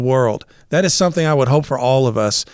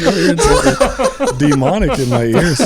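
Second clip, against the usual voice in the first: laughing; 4 semitones higher than usual. Retold or imitated. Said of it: "I'm like".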